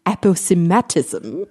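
aposematism said ap-oh-si-MAT-ism; original British pronunciation. Shown as a demonstration. Host showing how it was first pronounced, British pronunciation. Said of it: "probably first pronounced".

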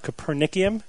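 Copernicum said co-per-NICK-ee-um, rising; questioning Copernicum with ee before um; extra I pronunciation. Shown as a demonstration. Host retries the hard name, Copernicum with extra ee, unsure. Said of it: "That extra I in there really throws me off".

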